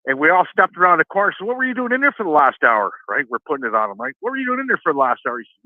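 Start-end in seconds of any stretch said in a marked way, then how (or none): 1.5-2.9 high mock interrogation; teasing question. Coworkers teasing the brother about the hour inside, high and mocking. 4.2-5.4 quick, needling repeat; teasing question, faster. Retold or imitated. Coworkers piling on the brother, quicker and teasing.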